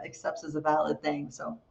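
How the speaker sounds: usual speaking voice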